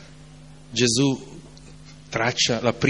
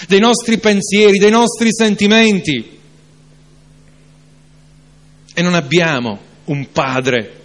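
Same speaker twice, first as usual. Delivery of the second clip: louder, higher preaching climax; fervent preacher rebuking doubt; preaching at full force; 6 semitones higher, 6 dB louder than usual. Acted. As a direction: build the sermon to a passionate peak. Preacher's rising passage.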